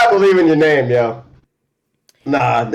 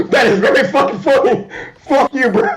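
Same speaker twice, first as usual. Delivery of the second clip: laughing; 9 semitones higher than usual. Real, not acted. Cracking up repeating line.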